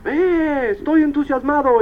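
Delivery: high-pitched mock cheer of excitement; imitated shouting. Rows of people yelling to each other, imitated shouting. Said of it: "Gritando uno a otro".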